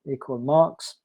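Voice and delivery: ordinary delivery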